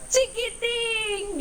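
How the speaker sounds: high, very slow drawn-out call; mother elephant calling her baby; joyful call. Acted. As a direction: sing out the little one's name. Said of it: "grita la elefantita".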